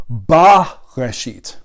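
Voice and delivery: "ba reshit" said very slowly, vowel "ba" drawn out; hypothetical pronunciation. Shown as a demonstration. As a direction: slowly spell out "ba reshit", stretching the "ba". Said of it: "would actually be something like".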